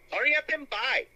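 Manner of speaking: imitating the shopkeeper lady in a mock Chinese accent; old Chinese lady. Retelling the film scene in a mock Chinese accent. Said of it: "and she says".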